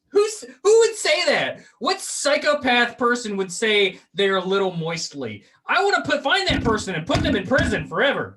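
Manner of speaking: worked-up, incredulous tirade; comic outrage. Real, not acted. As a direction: rant in loud disbelief at the very idea. Mock outrage over a word.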